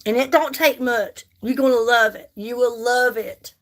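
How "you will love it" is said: normal talking voice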